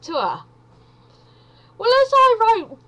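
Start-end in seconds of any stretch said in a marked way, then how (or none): 1.8-2.9 much higher and louder, bursting with the story; nearly squealing with embarrassed glee; excited squeal. Real, not acted. Embarrassed build-up to punchline.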